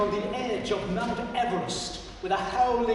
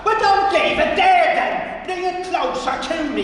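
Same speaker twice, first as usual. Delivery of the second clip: Pat demanding the basin be moved; snarl; 11 semitones higher, 10 dB louder than usual. Acted. Angry old man, loud snarl. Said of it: "he snarled".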